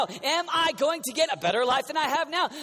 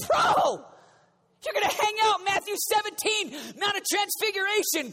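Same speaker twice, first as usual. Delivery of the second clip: hyped-up Jesus; 8 semitones higher than usual. Pretend. Jesus hyping Peter.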